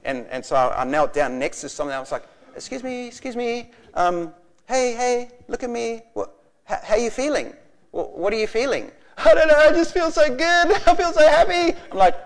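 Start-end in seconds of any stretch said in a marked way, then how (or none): 2.6-8.9 higher-pitched, careful checking-in voice; concerned minister. Retold or imitated. Preacher kneeling by children on the floor, concerned. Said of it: "I got a bit concerned". 9.2-11.7 put-on very high, loud wailing child voice; overwhelmed happy kids. Children on the floor answering the preacher, tearfully happy.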